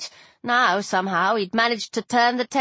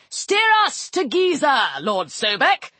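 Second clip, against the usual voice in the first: put-on old sun god voice; 4 semitones higher than usual. Ra commands.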